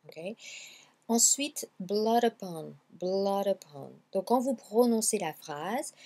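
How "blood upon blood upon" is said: "blood upon" joined as "bloo-dupon"; linked words. Shown as a demonstration. Teacher tells learners to link words, "blood upon" said as one. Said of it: "liez bien les mots".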